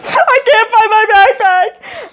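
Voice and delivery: put-on high, slow, tearful little-kid wail; imitating a crying first grader; crying child. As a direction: sob it out like a lost six-year-old. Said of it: "'Cause I'm crying at this point".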